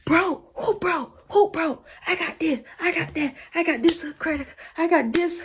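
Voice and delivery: high mock protest in short bursts; mocking boast. Mimicking the man denying he is broke, high mock boast.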